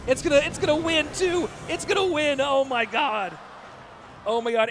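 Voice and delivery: high, loud, stunned caster's cry; real astonished excitement. Real, not acted. Caster watching an unlikely push win, astonished and excited. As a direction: exclaim in disbelief as it happens.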